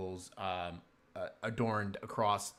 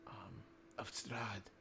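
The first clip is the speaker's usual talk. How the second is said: fearful whisper; 15 dB quieter, slower than usual. Acted. Sister dares to name the feared devil, whispering. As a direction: whisper the name, slow and scared.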